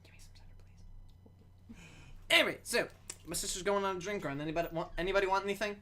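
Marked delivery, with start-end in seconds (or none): 0.0-1.8 hushed whisper. Real, not acted. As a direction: whisper the request. Asks for cider at a whisper.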